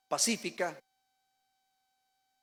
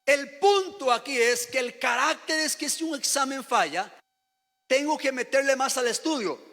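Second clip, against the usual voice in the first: higher, louder, emphatic sermon voice; preacher exhorting the congregation to persevere; fervent preaching; 5 semitones higher, 4 dB louder than usual. Acted. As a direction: drive the point home to the church. Preaching with rising force.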